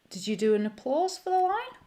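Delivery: ordinary delivery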